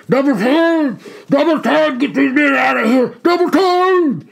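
rally the column to run; shouting. Acted. Colonel ordering the column to double time, shouting. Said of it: "shouting as best he could".

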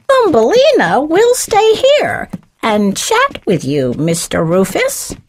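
sugary high hostess, easing down at the end; sweet voice. A narrator's reading. Missus Gray leaving the two to chat, sweetly. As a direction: offer sweetly, starting very high. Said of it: "in a sweet voice".

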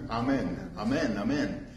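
"Amen" said as AH-men, soft and low; soft AH-men, repeated three times; Aramaic amen. Shown as a demonstration. Preacher showing the Aramaic word to the congregation, soft AH-men. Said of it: "the word is amen".

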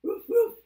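high, loud double woof; dog bark. Pretend. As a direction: bark like an excited doggy. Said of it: "what does a doggy say".

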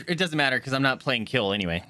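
usual speaking voice

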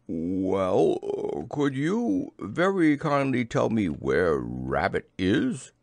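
lightly voiced slow, polite bear; Pooh. A narrator's reading. Pooh asking the hidden voice for Rabbit, slow polite request. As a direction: ask it carefully, drawn out.

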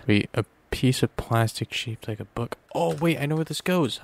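ordinary talk, nothing marked

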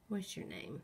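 usual speaking voice

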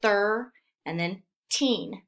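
put-on thir and teen said as separate beats; split into syllables. Shown as a demonstration. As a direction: break it into thir, then teen. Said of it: "that's our first syllable".

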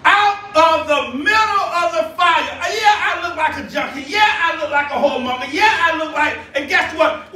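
insistent rhythmic sermon delivery; impassioned preaching. Real, not acted. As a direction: cry the verse out and build on it. Preacher takes up the verse forcefully.

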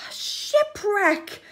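slow, high, delighted exclaim; excited shout. Acted. Crab spotting a shipwreck full of loot, excited shout. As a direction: call out a treasure find. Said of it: "Fab shouted".

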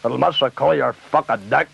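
normal talking voice

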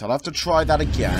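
ordinary delivery

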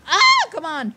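high, loud yelp of exasperation; frustrated cry. Real, not acted. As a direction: cry out at a bad play. Misses out in game.

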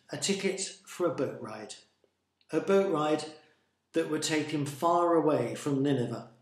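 ordinary delivery

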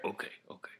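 unvoiced whispered okay. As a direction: whisper it softly. Whispered reply.

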